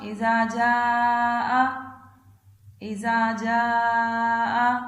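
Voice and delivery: slow, drawn-out tajweed chant, phrase by phrase; Quran recitation. Acted. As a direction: chant each phrase slowly for the child to repeat. Teacher recites Surah Nasr again.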